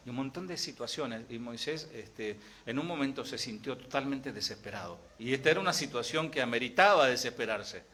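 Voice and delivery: usual speaking voice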